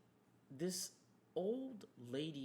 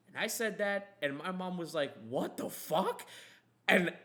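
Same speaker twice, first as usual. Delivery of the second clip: louder excited punchline telling; excited storytelling; 3 semitones higher, 7 dB louder than usual. Real, not acted. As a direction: build the story's climax. Telling the wild payoff.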